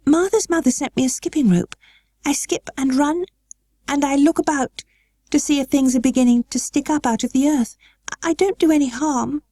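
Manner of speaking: put-on high girlish voice, pleading a little; a child anxious to be allowed; eager girl Mary. A narrator's reading. Mary pleading with her guardian, high and eager.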